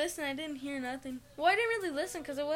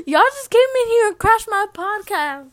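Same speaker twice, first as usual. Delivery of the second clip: loud, higher-pitched protest; exasperated complaint; 6 semitones higher, 17 dB louder than usual. Real, not acted. Teen protests family crashing the podcast, exasperated. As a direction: complain at top volume.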